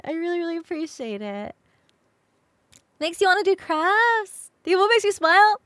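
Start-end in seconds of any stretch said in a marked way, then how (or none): 3.0-5.6 higher, louder upbeat delivery; bright streamer voice. Acted. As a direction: pitch it up cheerfully. Bright intro pitch.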